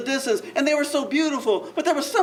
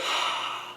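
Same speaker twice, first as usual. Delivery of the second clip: sigh; 8 dB quieter than usual. Shown as a demonstration. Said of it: "they do this".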